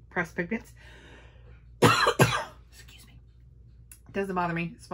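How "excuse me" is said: coughing through the words; choking cough. Real, not acted. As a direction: choke and cough out an apology. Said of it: "why I'm choking on absolutely nothing".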